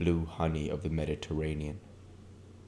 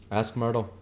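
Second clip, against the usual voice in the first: higher, amused reply; Tom's voice; 6 semitones higher than usual. Acted. Tom answers McKee, amused and raised. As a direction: toss it off with a laugh behind it.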